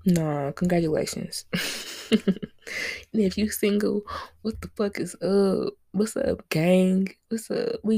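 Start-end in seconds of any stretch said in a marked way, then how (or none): 1.5-3.1 breathy laugh. Real, not acted. Laughs at own joke.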